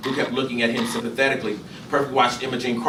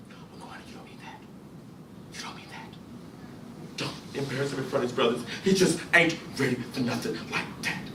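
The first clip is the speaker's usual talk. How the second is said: breathy, near-whispered begging; a mother quietly begging her husband to spare their son; hushed plea; 3 semitones higher, 6 dB quieter than usual. Acted. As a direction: plead softly so the boys won't hear. Imogene pleading softly.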